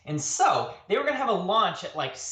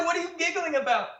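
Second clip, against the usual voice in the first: laughing question; 12 semitones higher, faster than usual. Retold or imitated.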